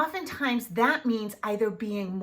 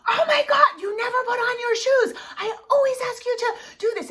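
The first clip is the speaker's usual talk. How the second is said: shrill, much higher, loud and fast; dysregulated yelling; 13 semitones higher, 6 dB louder, faster than usual. Shown as a demonstration. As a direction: lose it and shriek at the child. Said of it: "you start yelling and you get dysregulated".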